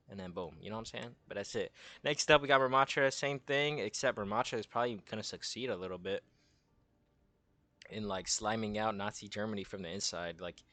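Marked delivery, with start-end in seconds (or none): none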